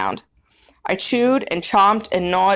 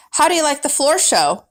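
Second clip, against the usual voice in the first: joking janitor; 7 semitones higher than usual. Acted. Janitor jokes while dancing.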